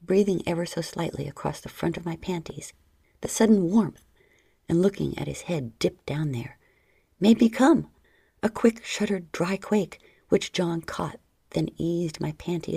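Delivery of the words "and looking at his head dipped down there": plain reading voice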